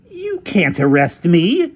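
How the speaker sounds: high, loud, drawn-out protest; indignant cry. Acted. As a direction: cry out in outraged disbelief. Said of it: "cried Featherstone".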